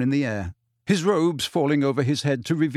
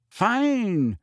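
higher, cool dismissal; 8 semitones higher than usual. A narrator's reading. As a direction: coolly brush him off. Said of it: "she said coolly".

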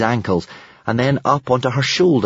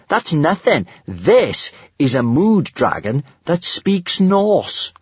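higher, emphatic one-upping; snorted boast; 7 semitones higher, 3 dB louder, slower than usual. A narrator's reading. Kamikaze shows off her talking dragon, boastfully. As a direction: show off with scornful pride. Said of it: "snorted Kamikaze".

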